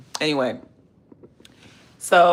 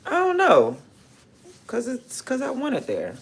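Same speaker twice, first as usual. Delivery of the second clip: high surprised reply; 10 semitones higher than usual. Real, not acted. Reacts to chat question.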